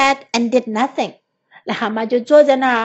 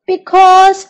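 wail; 6 semitones higher, 7 dB louder than usual. A narrator's reading. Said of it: "wailed Toad".

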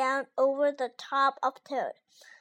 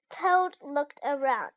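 ordinary delivery

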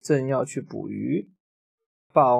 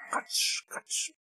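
crunching noise; 12 dB quieter than usual. Pretend. T-rex chews fruit.

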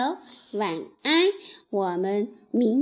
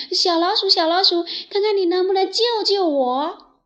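higher, louder, fast and urgent; hurried plea; 7 semitones higher, 6 dB louder, faster than usual. Acted. Tied-up lion hastily begs the mouse for help. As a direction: rush the plea out. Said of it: "赶紧说".

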